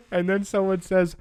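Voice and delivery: ordinary talk, nothing marked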